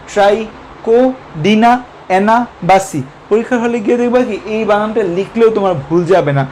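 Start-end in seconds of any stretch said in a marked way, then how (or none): 0.1-3.0 ট্রাইকোডিনা অ্যানাবাসি drawn out slowly in parts; syllable by syllable. Shown as a demonstration. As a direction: chop ট্রাইকোডিনা অ্যানাবাসি into slow pieces. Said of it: "পরীক্ষার হলে যদি এভাবে মনে রাখো". (ভাগ করে ধীরে উচ্চারণ)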